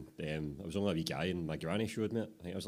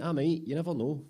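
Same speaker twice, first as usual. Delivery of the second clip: higher, louder cheeky protest; defensive apprentice; 10 semitones higher, 5 dB louder than usual. Acted. Apprentice answers the teasing with a higher defensive protest. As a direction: bristle back at your mate.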